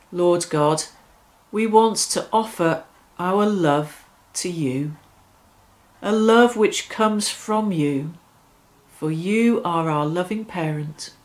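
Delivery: ordinary talk, nothing marked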